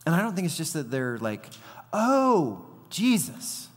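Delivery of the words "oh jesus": high, exclaimed "oh" of recognition; mock relieved recognition. Disciples recognizing Jesus on the water, exaggerated surprise. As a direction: play up the sudden realization.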